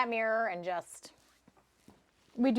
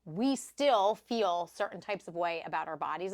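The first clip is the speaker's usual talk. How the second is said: ordinary delivery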